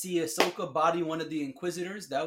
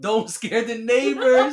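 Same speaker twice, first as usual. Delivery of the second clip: higher, slower, laughing loud repeat; loud mocking echo; 7 semitones higher, 11 dB louder, slower than usual. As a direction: belt it out teasingly. Loudly mocks request to be quiet.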